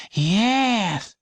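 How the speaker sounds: put-on high, slow child voice; a small girl calling to a stranger; high childish question; curious little girl. A narrator's reading.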